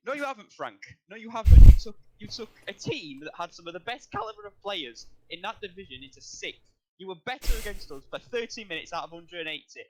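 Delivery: angry rant. Real, not acted. Ranting straight at Lampard.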